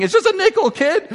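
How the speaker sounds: high, loud mock exasperation; incredulous dad protest, hammed up; exasperated parent. Exasperated parent in tantrum story.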